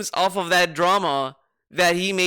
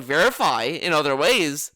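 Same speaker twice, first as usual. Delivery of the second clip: ordinary talk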